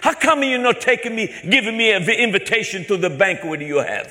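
high, loud, pleading broken English; mock-naive broken-English caller. Put-on caller changes the subject.

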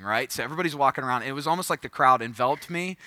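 ordinary delivery

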